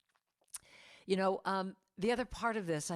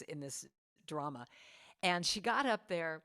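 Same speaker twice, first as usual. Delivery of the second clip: ordinary talk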